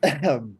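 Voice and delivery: hard throat clearing; coughing up water. Real, not acted. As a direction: clear the throat after choking. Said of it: "it went down the wrong pipe there".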